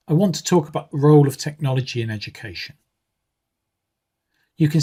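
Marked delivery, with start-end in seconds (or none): 0.1-2.7 content words want, talk, role and technology punched, function words quick and light; stress on want, talk, role, technology. Shown as a demonstration. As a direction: hit the content words, rush the small words. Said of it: "Listen how I say it with, um, the words in capitals stressed".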